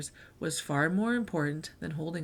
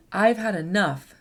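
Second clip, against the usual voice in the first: louder, fed-up declaration; firm outburst; 7 dB louder than usual. Acted. Fern telling off the rude unicorns, loud and fed up. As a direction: slam the line down, done with it.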